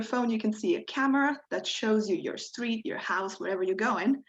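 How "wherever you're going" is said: ordinary talk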